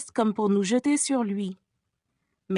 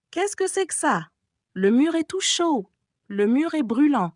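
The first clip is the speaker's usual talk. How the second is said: higher, rising panic; anguished fear; 6 semitones higher than usual. A narrator's reading. Christine feeling the burning wall, in anguish. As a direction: gasp it out in anguish. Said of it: "la voix râlante d'angoisse de Christine".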